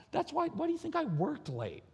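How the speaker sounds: high-pitched comic protest; mock exasperation. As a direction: let the question leap up high. Husband's exasperated confession.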